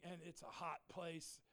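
usual speaking voice

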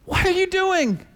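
put-on high, slow, dismayed kid voice; stunned little boy. Four-year-old finding his garage wrecked, high and dismayed. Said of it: "finally he said".

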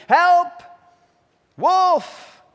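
high, loud cry of Help and Wolf; shouting for help. A narrator's reading. Red flees the wolf, loud high shouts for help. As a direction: scream for the woodsman. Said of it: "shouting as loudly as she could".

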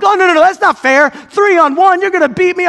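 hammed-up whining protest; talking smack. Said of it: "I'm talking smack".

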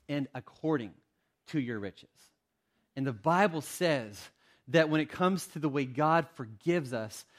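ordinary delivery

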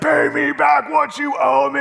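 repeating the reader's menacing line; imitating Randy's reading. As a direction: mimic the reader's angry demand. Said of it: "You heard Randy read it. He's like".

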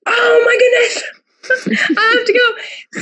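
very high-pitched mock squeal breaking into a laugh; retold excited squeal. Guest reenacts hearing of the Scotland trip, hammed-up excited squeal. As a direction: squeal it like an overexcited, hammed-up reaction. Acting out reaction to trip announcement.

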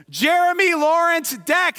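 high, ringing full-name call. Shown as a demonstration. Preacher singling out a listener by full name, calling out. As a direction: sing out the whole name to the room. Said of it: "I just called it out for everybody".

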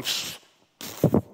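sharp scornful pfft blown out. Retold or imitated. Crowd sneering at Jesus with a scornful pfft. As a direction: blow the sound off in contempt. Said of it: "looking at Jesus and going".

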